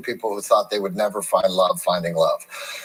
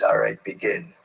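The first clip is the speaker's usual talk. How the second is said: a cool, unhurried Clint Eastwood calling the start; Clint Eastwood impression; 3 semitones lower than usual. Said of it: "That's a really good Clint Eastwood".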